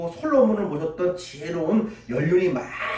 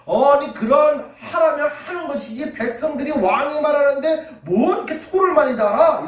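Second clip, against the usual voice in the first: high, loud scoffing at the people; scornful friends; 8 semitones higher, 4 dB louder than usual. Retold or imitated. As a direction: sneer at those who talk back. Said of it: "친구들이 말하기를". (높고 크게 비웃는 친구들)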